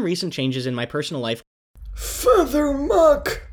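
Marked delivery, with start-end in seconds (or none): 1.9-3.4 high, loud, drawn-out minced oath; theatrical exasperated shout; mock cursing. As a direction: yell it slowly like a stifled swear. Minced swearing outburst.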